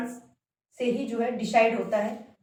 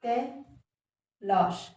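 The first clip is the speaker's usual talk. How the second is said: तलाश stretched very slowly, falling; slow drawn-out correct word; much slower than usual. Shown as a demonstration. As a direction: draw तलाश out slowly for dictation. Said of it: "तलाश होता है".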